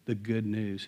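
normal talking voice

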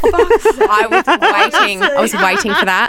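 laughter bubbling through the words; laughing reaction. Real, not acted. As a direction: talk through amused laughter. Teasing reaction amid laughter.

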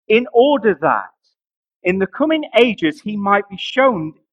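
loud, slow, high proclaimed reading; slow, loud Bible reading; scripture reading. Acted. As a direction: declare the verse to the church. Reads scripture aloud.

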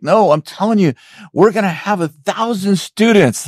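quoting a friend who won't be talked out of it; imitating her insistence. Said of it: "She's like".

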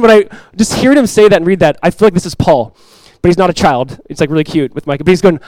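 usual speaking voice

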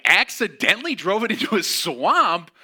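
loud, high disbelieving echo; shocked disbelief. Retold or imitated. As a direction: repeat her words in loud shock. Shocked reply to mom's news.

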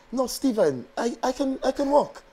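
high-pitched imitation of a German client; German accent. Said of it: "That's the best German accent".

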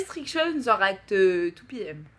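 hesitant French-accented English; halting French receptionist accent. Shown as a demonstration. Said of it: "my French accent, I hesitate".

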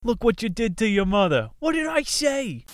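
shrieking Italian mother scolding at the table. Acted. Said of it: "into their Italian".

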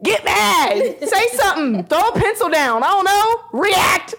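high-pitched, exasperated commands; mad. Acted. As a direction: goad him with mock frustration. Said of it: "how you mad that the person not upset?".

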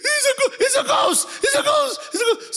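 shrill panic shout from the boat; panicked shout. Retold or imitated. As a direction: yell it like terrified fishermen. Disciples shouting about a ghost.

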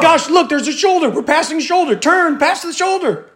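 very high mock sportscaster; hammed-up commentator calling the big moment; play-by-play. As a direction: pitch it way up like a frantic game call. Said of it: "is it like play by play?".

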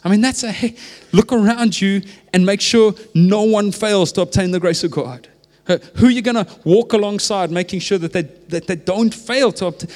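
high, loud, urgent exhortation; impassioned preacher building to a peak; preaching at full force. Acted. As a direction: rise up and press it on the room.

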